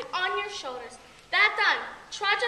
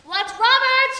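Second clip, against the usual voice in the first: calling out; 6 semitones higher, 9 dB louder, slower than usual. Acted. Calling servants in play.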